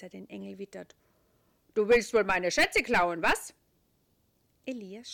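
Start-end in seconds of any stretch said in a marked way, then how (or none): 1.7-3.5 loud gruff terrier; barking dog voice. A narrator's reading. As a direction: bark the words like a dog. Dog character.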